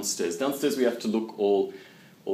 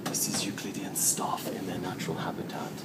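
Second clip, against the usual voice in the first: hushed documentary whisper; nature documentary; 4 semitones lower, 7 dB quieter than usual. Pretend. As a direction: whisper like stalking wild animals.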